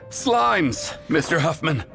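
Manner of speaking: high, frightened announcement; panicked report. Acted. Messenger reports monsters to the knight, panicked. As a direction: blurt out the threat in alarm.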